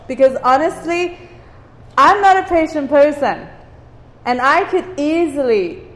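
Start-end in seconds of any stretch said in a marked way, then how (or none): none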